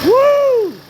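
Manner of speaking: high ecstatic whoop; gym bro whoop. Real, not acted. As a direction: let out a huge victory whoop. Celebrating, about to ride.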